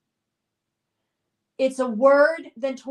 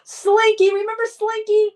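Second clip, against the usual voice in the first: loud and high with delight; thrilled nostalgic exclamation; excited reveal; 9 semitones higher, 7 dB louder than usual. Real, not acted. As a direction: announce the answer like a prize. Revealing the game answer.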